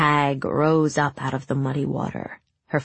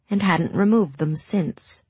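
ordinary delivery, nothing marked

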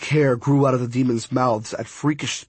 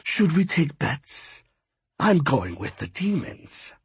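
amused villain; 2 semitones higher, 3 dB quieter than usual. A narrator's reading. Apuch watching the battle with captive, slyly amused. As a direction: toy with the captive, unhurried.

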